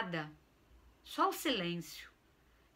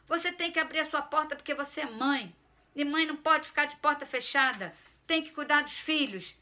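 higher, louder scolding of his mother; indignant lecture; 6 semitones higher, 4 dB louder than usual. Acted. Son lectures mother about open doors, indignant. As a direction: lay down the law to mom. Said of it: "Apelei mesmo".

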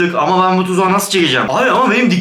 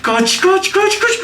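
panicked; 14 semitones higher, much slower than usual. Real, not acted. Fleeing creatures in panic.

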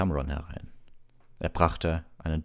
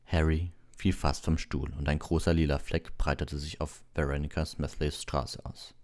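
plain reading voice

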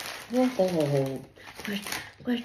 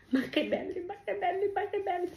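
soft cooing; 10 semitones higher, 7 dB quieter, faster than usual. Real, not acted. Grandma coos over gifts.

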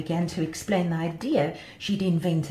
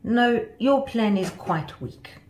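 slow, higher-pitched dismissal; scoffing; 5 semitones higher, 4 dB louder, slower than usual. A narrator's reading. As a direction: scornfully draw out the verdict. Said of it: "Sue scoffed at her friend".

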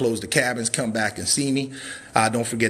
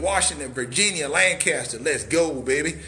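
usual speaking voice

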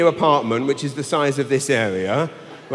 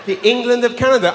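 mocking repeat; 7 semitones higher, faster than usual. Shown as a demonstration. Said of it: "repeated with incredulity".

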